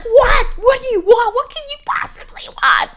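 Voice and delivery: high, loud angry outburst; exasperated yelling. Retold or imitated. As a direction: yell at someone who keeps bothering you. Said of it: "yelled at you like there was no tomorrow".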